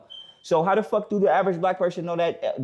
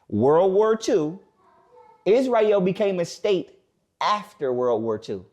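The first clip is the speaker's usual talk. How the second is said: very slow, spaced-out delivery; deliberate, drawn-out restatement with pauses; slow emphatic repeat; 4 semitones higher, slower than usual. Real, not acted. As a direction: spell it out phrase by phrase. Repeating the point slowly for emphasis.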